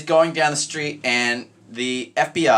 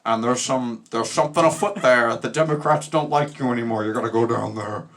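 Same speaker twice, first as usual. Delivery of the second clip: gravelly mock-politician voice; gravel-throated LBJ. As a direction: play an old-time politician with gravel in the throat. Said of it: "He had so much gravel in his throat".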